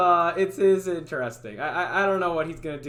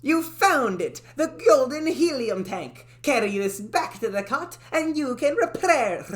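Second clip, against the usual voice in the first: high cartoon character voice; 7 semitones higher, 4 dB louder than usual. Game character congratulates the player, high and excited. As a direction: announce the find with delight. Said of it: "talking like this".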